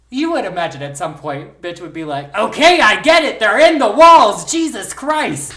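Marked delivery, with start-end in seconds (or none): 2.4-5.5 high, loud fed-up yell; exasperated outburst. Pretend. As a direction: shout it like you've had enough. Imagined fed-up ghost-hearer.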